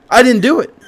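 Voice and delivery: pitched high and loud, protesting innocence; mock innocent protest. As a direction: whine that you didn't do it.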